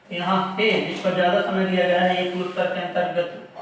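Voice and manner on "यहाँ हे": normal talking voice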